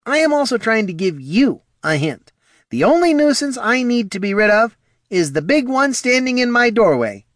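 high, curt dismissal of an unwanted guest; Poldo, dismissive. A narrator's reading. Poldo showing the exterminator the door, curtly.

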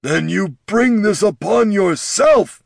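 high, loud, slow bellow. A narrator's reading. Chieftain bellowing at his sister in rage. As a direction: roar it in rage. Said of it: "he bellowed".

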